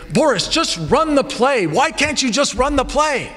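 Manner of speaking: high-pitched, pleading exasperation; exasperated scolding. Retold or imitated. Coach stops a player heading to the bench, exasperated.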